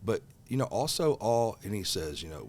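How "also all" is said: usual speaking voice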